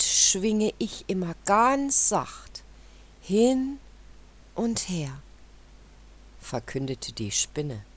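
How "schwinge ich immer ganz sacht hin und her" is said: whispered gently. A narrator's reading. Spider describing gentle swinging.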